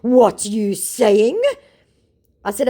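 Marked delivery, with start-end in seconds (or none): 0.0-1.4 very slow, high, loud scammer; mock scammer voice. Mocking scammer puzzled by her, very slow. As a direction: drawl it out confused. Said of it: "To which he said".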